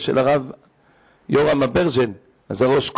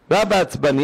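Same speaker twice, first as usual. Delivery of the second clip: ordinary talk, nothing marked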